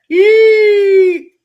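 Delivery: big high yelp of excitement; excited whoop. Real, not acted. Host cheering as a guest shows up, loud excited whoop.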